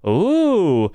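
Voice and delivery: a thrilled ooh at the news; excited ooh. Real, not acted. Gamer reacting to a flamethrower in patch notes, excited ooh.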